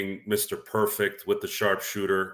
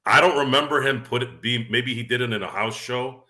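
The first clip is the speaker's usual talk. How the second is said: ordinary talk, nothing marked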